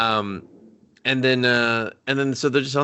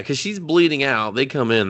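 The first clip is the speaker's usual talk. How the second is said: ordinary talk, nothing marked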